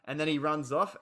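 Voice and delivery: ordinary delivery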